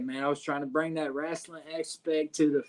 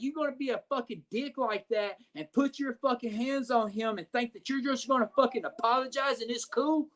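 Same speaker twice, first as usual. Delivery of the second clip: higher-pitched mock indignant rant; retold angry rant; 7 semitones higher than usual. Friend rants at the guy who apologized, higher mock angry rant. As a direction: throw the friend's outrage back at the guy, voice pitched up.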